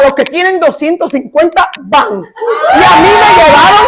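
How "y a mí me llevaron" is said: rising high and loud in triumph; joyful exclaim, pitched way up; triumphant cry. Real, not acted. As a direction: proclaim it as a victory. Triumphant climax of testimony.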